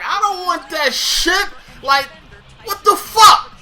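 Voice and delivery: high, slow disbelieving outrage; in his head, hitting back at the cancelled interview; mock outrage. Job seeker's inner voice, mock outraged disbelief.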